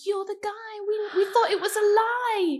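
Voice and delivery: high, dramatic mock wail; astonished station staff. Imitating Eurostar staff meeting the guy, high-pitched astonishment. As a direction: squeal it in mock disbelief. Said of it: "And they were like".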